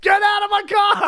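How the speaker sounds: high, loud yelled line; hammed-up shout quoting the song; mock shout. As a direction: belt it out like the angry driver.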